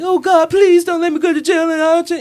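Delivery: high, loud whiny plea; mock begging. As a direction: ham up a desperate prayer. Mock criminal begging God.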